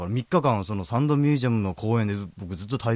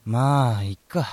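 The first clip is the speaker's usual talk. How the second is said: too cool; much slower than usual. Pretend. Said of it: "そんな格好つけちゃダメ".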